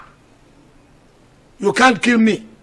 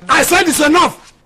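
firm shout; 6 semitones higher, 4 dB louder than usual. Acted. Husband stops the quarrel.

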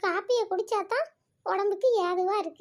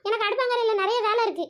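ordinary talk, nothing marked

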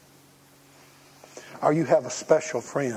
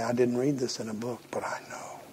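intimate whisper; 2 semitones lower, 5 dB quieter than usual. Retold or imitated. Comforting a grieving person, hushed whisper. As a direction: lean in and whisper the truth. Consoling someone in deep pain.

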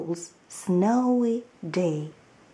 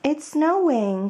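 higher, lighter call to a friend; Bunny calls Mole out to play; excited cry; 6 semitones higher, 3 dB louder than usual. Acted. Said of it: "cried Bunny".

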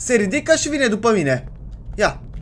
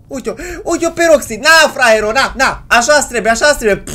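loud, raised-pitch jeering; gloating taunt; 5 semitones higher, 6 dB louder than usual. Real, not acted. Player taunts beaten Roxy, gloating and jeering. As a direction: rub it in with gleeful scorn. Taunting the defeated game enemy.

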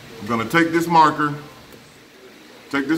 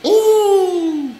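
long, high, loud "ooh"; excited ooh; 8 dB louder than usual. Real, not acted. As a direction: draw out a big high reaction ooh. Reacts to a near miss.

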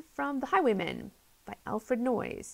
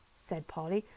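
plain reading voice

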